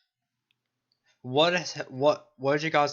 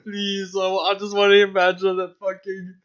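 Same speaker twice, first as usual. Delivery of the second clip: excited pleading; 7 semitones higher, 8 dB louder than usual. Real, not acted. Excited laughing outburst.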